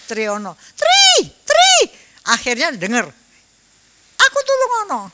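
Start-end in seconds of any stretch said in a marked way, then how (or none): 0.7-1.7 high, loud shout of a name; calling out a name. Retold or imitated. Fallen man shouting to a neighbour for help, loud high call. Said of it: "saya cuman teriak-teriak". 4.2-5.0 high, carrying call for help. Retold or imitated. Fallen man begging a neighbour to help, raised high call. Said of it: "saya cuman teriak-teriak".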